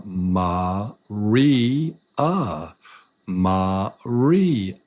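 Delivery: "Maria" broken into three long, separate beats; syllable by syllable. Shown as a demonstration. Teacher trying a learner's name, "Maria" in three separated syllables. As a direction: space out each syllable of "Maria". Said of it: "There's three".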